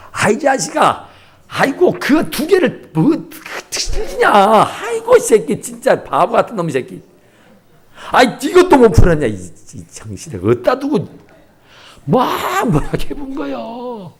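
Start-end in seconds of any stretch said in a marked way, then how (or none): 0.1-11.1 angry father. Retold or imitated. Father berating child's mistakes.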